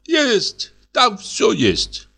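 high-pitched, halting foreigner voice; a naive Greek guest, high and slow; Greek man voice. Acted. Dymba answers again. (высокий голос грека)

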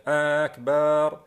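أكبر stretched into "aakbaar"; added alif after ba. Shown as a demonstration. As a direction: lengthen the vowels of أكبر, especially after the b. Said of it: "وضع ألف بعد الباء فتصبح أكبر". (أكبر بألف ممدودة بعد الباء: أكبار)

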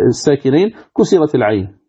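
ordinary delivery

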